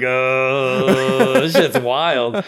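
loud, high, slow amazed voice; impressed onlookers. Retold or imitated. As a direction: draw out the awe at the scene. Onlookers' reaction impersonated.

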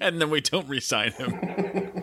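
slow, high, laughing through words. Real, not acted. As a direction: laugh it out slowly. Joking punchline with laugh.

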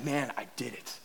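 hushed, low murmur of achievement; quiet pride. Retold or imitated. Rider to himself after each roller coaster, quiet pride. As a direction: keep it soft and inward, a private victory. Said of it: "and I felt like".

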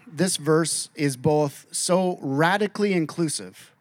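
ordinary delivery

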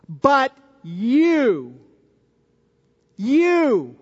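"But you, you" with "you" punched and drawn out; emphatic you. Shown as a demonstration. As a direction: stress on you, held and repeated. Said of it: "emphatically saying this, "you".

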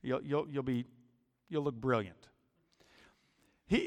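ordinary talk, nothing marked

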